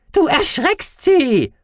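very high, loud creature voice; slow, squeaky indignant yell; called out. Acted. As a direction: shout squeakily in her defence. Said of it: "rief er".

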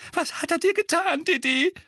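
very high, trembling distress; anxious mother, high and loud. Acted. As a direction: ask in a high, upset quaver. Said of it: "mit zitternder Stimme".

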